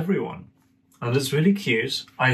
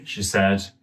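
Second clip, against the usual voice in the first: ordinary delivery, nothing marked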